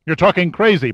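sharp, high and loud retort; snapping. A narrator's reading. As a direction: snap it back at him.

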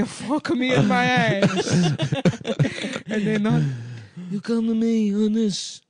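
high, pleading Italian shopkeeper; laughing through a mob plea. Pretend. Godfather undertaker parody.

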